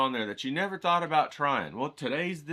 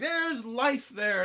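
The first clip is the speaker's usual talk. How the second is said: slow, high and hushed in wonder; awed hush; 10 semitones higher, much slower than usual. Retold or imitated. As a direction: stare at his painting in awe. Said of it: "I'm like".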